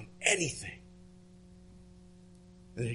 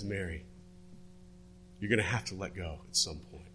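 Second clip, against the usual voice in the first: a hint of Jesus, low and soft; quiet Jesus; 6 semitones lower, 6 dB quieter than usual. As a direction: speak lower and gently, as Jesus sending her off. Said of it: "And he says".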